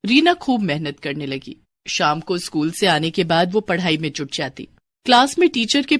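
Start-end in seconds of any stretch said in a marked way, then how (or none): none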